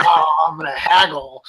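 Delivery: light cackly crone imitation; old witch voice. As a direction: play a fussy old hill witch. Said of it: "an old witch on the, on the hill".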